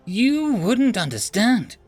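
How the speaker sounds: high, loud spoiled daughter; whiny alien princess. Acted. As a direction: plead like a spoiled royal.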